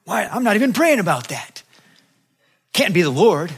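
acted-out baffled denial; mock indignant protest. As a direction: push back at the unwelcome thought. Acted puzzled protest during prayer.

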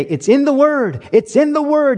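hammed-up evangelist proclaiming from the pulpit; imitating preacher's shout. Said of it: "Wave his Bible and say".

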